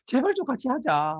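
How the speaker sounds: high, slow mock begging for a partner; pleading. Desperate seller imitated, begging a friend to join: high slow pleading. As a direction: whine it out like a desperate recruiter.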